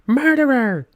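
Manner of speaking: loud, high cry of accusation; accusing shout. Acted. Accuser denouncing a murderer, shouting high and loud. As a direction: yell it out like pointing at a killer.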